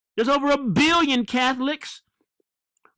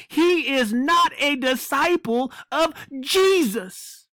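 ordinary talk, nothing marked

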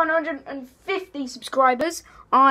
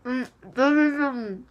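cheeks stuffed full. Real, not acted. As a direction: mouth crammed with marshmallows. Mouth crammed with marshmallows.

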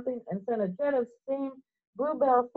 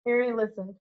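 ordinary delivery, nothing marked

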